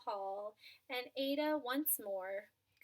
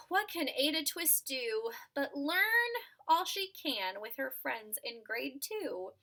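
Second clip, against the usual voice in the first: plain reading voice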